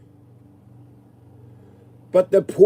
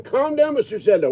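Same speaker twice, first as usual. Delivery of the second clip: mock phone rep; 7 semitones higher than usual. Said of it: "when they tell me on the phone".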